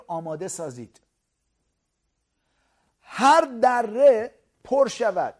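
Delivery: loud, slow, drawn-out proclamation; fiery preaching. Acted. Preacher crying Isaiah's words to the church, fiery. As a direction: cry it out like the voice in the wilderness.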